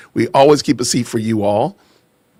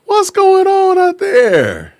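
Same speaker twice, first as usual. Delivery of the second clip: slow, very high drawn-out greeting; hometown shout-out; 4 dB louder, much slower than usual. Real, not acted. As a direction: call out to far-off friends, stretched high. Calling out to hometown viewers.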